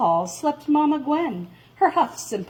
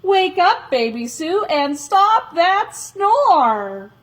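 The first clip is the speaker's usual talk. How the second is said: loud call; 7 semitones higher, 5 dB louder than usual. Acted. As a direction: call out loud to wake her. Calling to Baby Sue.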